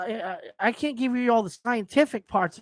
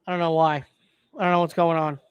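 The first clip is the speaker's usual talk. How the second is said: usual speaking voice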